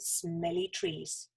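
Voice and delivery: usual speaking voice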